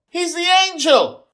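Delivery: put-on girl's very high, loud cry; top-of-voice shout. A narrator's reading. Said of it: "Joy shouts at the top of her voice".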